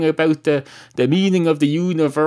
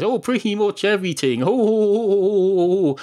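high, comic string of oh oh oh in a strange voice; hammed-up high oh oh oh; mock exclamation; 7 semitones higher than usual. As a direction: camp it up with a squealing run of ohs. Counter man exclaims in put-on voice.

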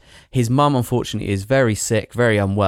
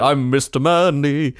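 big, dumb tough-guy voice; macho voice; 3 semitones higher than usual. Retold or imitated. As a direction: puff up like a macho hunk. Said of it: "he's like".

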